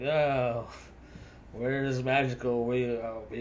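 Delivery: ordinary delivery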